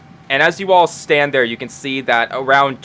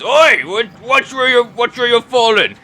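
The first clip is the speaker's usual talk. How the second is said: higher, louder gruff pirate snapping; startled pirate barks at someone stumbling into him; annoyed pirate; 10 semitones higher, 5 dB louder than usual. Acted. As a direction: shove back at a clumsy stranger, loudly. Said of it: "he just goes".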